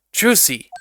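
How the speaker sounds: loud, high call. Acted. Detective calls to partner to cut her off, loud call. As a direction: shout her name to stop her.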